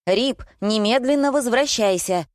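возмущённый окрик повыше; возмущённо позови непослушного щенка; возмущение. A narrator's reading. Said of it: "возмутилась я". (возмущённый приказ)

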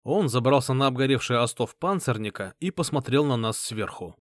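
plain reading voice

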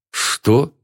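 high, startled question; dumbfounded. A narrator's reading. Friend hears the marriage news, dumbfounded. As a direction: react completely taken aback. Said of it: "Я просто опешил".

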